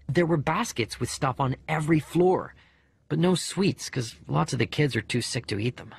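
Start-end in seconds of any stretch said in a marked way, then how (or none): none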